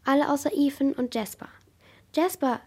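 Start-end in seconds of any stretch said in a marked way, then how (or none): 2.1-2.6 calling out. A narrator's reading. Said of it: "rief Ethan".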